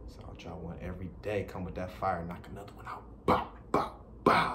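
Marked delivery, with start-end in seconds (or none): 3.3-4.5 punchy vocal "bow" bursts; mouth-made shots; imitated gunshot noises. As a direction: pop out short bang sounds. Imitated bangs at sign-off.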